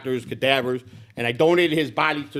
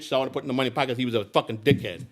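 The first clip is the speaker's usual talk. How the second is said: usual speaking voice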